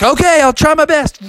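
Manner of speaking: high, determined cartoon kid; game Froggy. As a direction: pipe up eagerly before a run. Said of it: "And Froggy's like".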